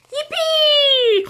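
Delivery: high excited whoop; joyful cry. Acted. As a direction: cheer like a happy piglet. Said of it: "cried Tom".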